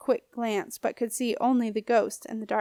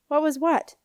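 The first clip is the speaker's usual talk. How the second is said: higher, mock-innocent question; feigned innocence; 6 semitones higher, 4 dB louder than usual. A narrator's reading. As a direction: play dumb, all innocence. Boy plays innocent.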